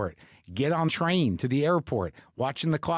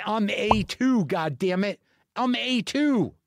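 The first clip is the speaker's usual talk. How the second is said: higher-pitched, exasperated protest; indignant insistence; 5 semitones higher, slower than usual. Retold or imitated. Traveler obsessed with his spot in line, indignant insistence. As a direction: insist on your rightful spot, worked up.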